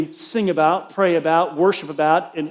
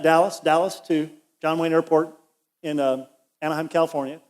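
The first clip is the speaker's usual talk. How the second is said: ordinary delivery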